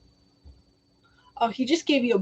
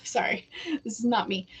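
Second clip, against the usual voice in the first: whispered aside; 7 dB quieter than usual. Real, not acted. Apologising aside while channeling.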